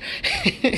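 a high grossed-out scoff; disgusted sniff reaction. Retold or imitated. Cop sniffing the blown magazine, grossed-out scoff. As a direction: react like smelling a fart. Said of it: "the cop's like".

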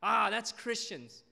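mock the smug critics of the church. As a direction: pastor parodying church critics with a gloating told-you-so. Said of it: "a lot of Christians see these kind of stories and be like".

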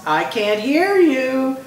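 high, slow drawn-out call; cheerleader goading. A narrator's reading. Cheerleader goads crowd for louder answer.

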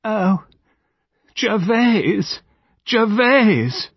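high, wailing cry of grief; anguished moaning call; shuddering moan. A narrator's reading. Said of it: "shuddering moan from Lady Chevenix Gore".

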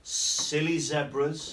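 slow, drawn-out rhyme reading; reading to children. A narrator's reading. Teacher reading rhymes to young pupils, slow and playful. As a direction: linger on each silly line. Reading rhyming picture book aloud.

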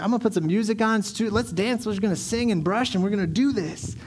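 high, excited rallying; party hype. Acted. Dad starting a teeth-brushing party for his kids, excited and high. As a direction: whip the kids up in one rising rush.

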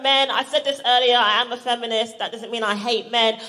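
ordinary talk, nothing marked

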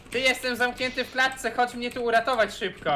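high pleading voice. Acted. Caught player pleads in cage.

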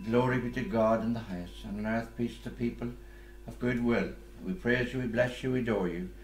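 Gloria proclaimed at Mass, phrase by phrase; recited hymn of praise. Acted.

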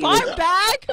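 high, loud disbelieving repeat; incredulous. Real, not acted. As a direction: echo the insult in shock. Offended at the insult.

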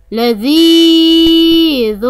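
long held ee in لذيذ; لذيذ said slowly with a sustained ee; elongated letter. Shown as a demonstration. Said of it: "حرف الذال ممدود بالياء".

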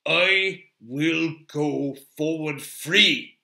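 slow, loud, defiant dwarf; stubborn and emphatic; obstinate Gimli. A narrator's reading. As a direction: declare it word by word, immovable. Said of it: "Gimli was obstinate".

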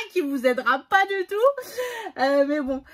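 high, loud laughing speech. Real, not acted. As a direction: laugh through the words at your own joke. Laughs at her own useless hint.

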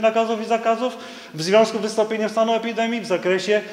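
normal talking voice

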